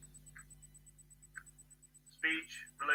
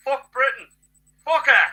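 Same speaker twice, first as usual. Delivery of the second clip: higher, louder angry outburst; angry shout; 8 semitones higher, 11 dB louder than usual. Real, not acted. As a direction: spit your fury at Britain. Ranting against Britain.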